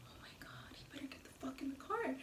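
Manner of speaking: barely audible, unvoiced-breath whisper to oneself; anxious whisper. Girl sitting in the car silently willing him in, whispered. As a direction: whisper it like a private panicked thought. Her nervous inner reaction, whispered.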